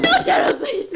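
very high loud pleading cry; desperate plea. Acted. Wife begging Gerald not to answer, desperate high cry. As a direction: beg him with everything.

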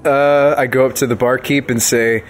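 ordinary talk, nothing marked

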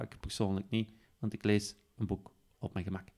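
ordinary talk

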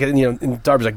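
normal talking voice

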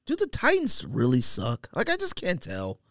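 ordinary delivery

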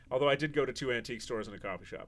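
ordinary talk

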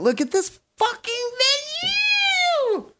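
very high-pitched squeal of amazement; high falsetto raving; excited squeal. Real, not acted. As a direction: squeal it out, stretching the last word. Raving over the castle venue.

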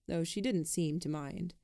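plain reading voice

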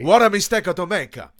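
loud, high mock Italian accent; Captain Bertorelli. Quoting the sitcom catchphrase to his co-host, loud mock Italian accent. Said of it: "Captain Bertorelli".

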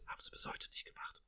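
sarcastic whisper to the viewers; whispered dry aside. Real, not acted. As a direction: whisper it under your breath. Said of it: "whispered sarcastic aside".

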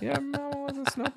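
high robot voice; high, squeaky movie-robot impression; Johnny Five voice. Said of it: "Oh, that was it".

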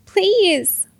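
high-pitched begging; drawn, imploring plea; desperate cry. A narrator's reading. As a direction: beg attackers for mercy. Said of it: "she cried".

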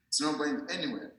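normal talking voice

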